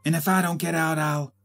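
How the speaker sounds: sharp vaudeville doll losing her temper; angry, sharper. A narrator's reading. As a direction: snap sharply at the girl. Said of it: "much deeper, much sharper now, angrily retorting".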